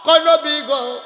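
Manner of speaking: high, loud sustained sermon chant; full-force preaching. Acted. Preacher voicing the Prophet to Ayesha, full-force chant. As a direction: cry it out loud and high, holding the notes.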